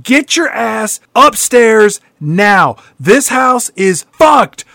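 high, hammed-up desperate shouting; mock scream, slowing to punch each word. Griffin warns his father about the house, screaming. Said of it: "Griffin is just screaming at his father".

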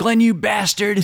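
high, drawn-out hammed-up scolding; mock pissed off. Girl wakes and finds boyfriend asleep, mock anger. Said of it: "she gets all pissed off at Glenn".

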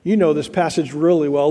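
ordinary delivery

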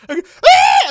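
high, loud mock death scream. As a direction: shriek as the kid gets bitten. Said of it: "And he's like".

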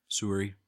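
"Surrey" for sorry, with a rounded first vowel; mimicked Canadian vowel in "Surrey". Imitating Canadian way of saying sorry.

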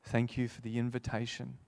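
earnest pastoral prayer voice; closing congregational prayer. Real, not acted. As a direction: pray over the room sincerely.

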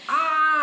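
loud high overwhelmed yelp; pained yelp. Retold or imitated. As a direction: cry out as the tannins hit hard. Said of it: "I was just like".

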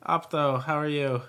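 normal talking voice

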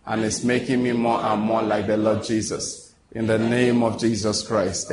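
slow, low prayer voice; closing prayer. Acted. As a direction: wind the prayer down slowly and solemnly.

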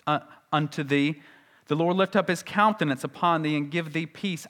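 measured, reverent King James recital; scripture reading. Acted. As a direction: intone the old Bible phrases with reverence. Reads scripture phrases aloud.

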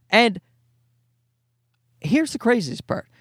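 ordinary talk, nothing marked

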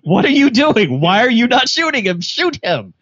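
high, mock-incredulous pleading; hammed-up exasperation; exasperated urging. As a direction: plead in disbelief like a frustrated viewer. Exasperated audience at the screen.

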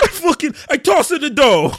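high-pitched cartoon Italian accent; mock Italian accent, as Mario. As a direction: play up a silly Italian pizza maker. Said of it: "That is not all the Italians".

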